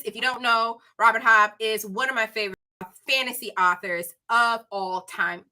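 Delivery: ordinary delivery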